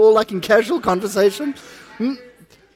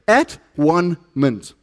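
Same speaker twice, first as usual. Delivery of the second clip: at one mint, each word slow and falling; slow emphatic refrain; 4 semitones lower, much slower than usual. Acted. Preacher to congregation, slow emphatic refrain. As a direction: drag out at one mint with weight.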